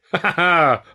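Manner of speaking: hearty acted laugh; spoken laugh. A narrator's reading. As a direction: laugh it out loud in words. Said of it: "I laughed".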